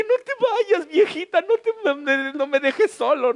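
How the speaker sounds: high, loud mock plea to mom; mock pleading. Suplica a la madre que se va. (súplica exagerada, aguda y fuerte)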